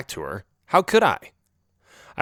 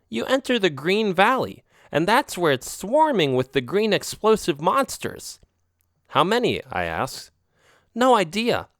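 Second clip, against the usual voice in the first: slightly higher, knowing alley cat; lightly voiced friend cat; 7 semitones higher than usual. A narrator's reading. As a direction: warn him off casually. Narrator voices Oreo.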